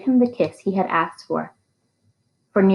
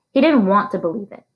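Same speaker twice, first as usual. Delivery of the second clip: plain reading voice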